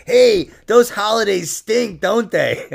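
slow hammed-up comic opener; mock stand-up joke. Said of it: "Be like".

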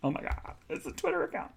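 whispered amazed aside. Real, not acted. Discovering the sandwich has a Twitter, whispered amazement. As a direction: breathe it out in surprise.

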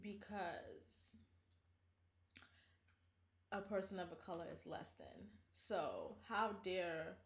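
soft, slow mocking reply; quiet mock sneer. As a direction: mockingly hush it out. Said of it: "why can't you be honest".

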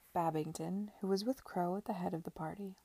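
plain reading voice

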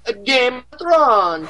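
slow, high mock Italian Game of Thrones; Italian accent. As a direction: stretch it out like a hammy Italian. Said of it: "your Game of Thrones as an Italian".

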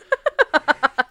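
high, loud laugh; high laughter. Real, not acted. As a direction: crack up. Laughs at own joke.

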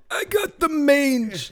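silly sick voice. Pretend. Joking in a sick old voice.

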